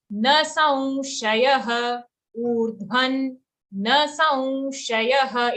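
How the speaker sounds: slow, drawn-out "Urdhvan na saunshayah"; demonstrated pronunciation. Shown as a demonstration. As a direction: stretch each syllable of "na saunshayah" for the learner. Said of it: "So we say urdhvan".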